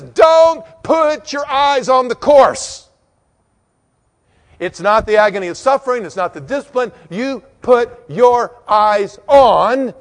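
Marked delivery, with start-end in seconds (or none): 0.0-2.9 loud, high, slow command; emphatic command. Real, not acted. Preacher quoting the writer, loud slow command. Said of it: "here the writer says". 7.1-9.9 loud, slow, drawn out; building emphasis. Real, not acted. Preacher building up, loud and slow.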